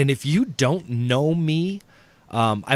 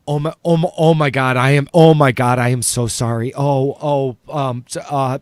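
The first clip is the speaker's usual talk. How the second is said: loud, stammering mock apology; panicked apology; 7 dB louder, faster than usual. Owner backs down before the caller, flustered apology. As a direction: fall over yourself saying sorry, hammed up. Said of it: "she started apologizing".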